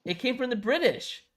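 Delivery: high, loud hammed-up British voice; mock British rant. Mocking British football fans, hammed-up British accent. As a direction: play an outraged Englishman. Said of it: "mocking British origin".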